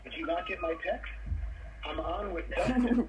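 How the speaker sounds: high mock scolding; playfully telling off his son; pretend mad. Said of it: "I'm gonna pretend to be mad at him".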